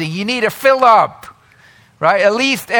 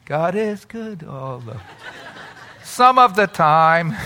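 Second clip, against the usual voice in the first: mock faltering, laughing; 4 semitones lower, 4 dB quieter than usual. Tuesday, faith fading.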